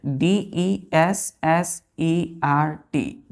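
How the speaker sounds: letters spelled very slowly; spelling out. Shown as a demonstration. Teacher spelling the word slowly for the class. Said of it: "कितने letter है?".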